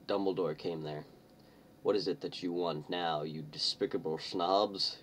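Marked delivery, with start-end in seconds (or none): none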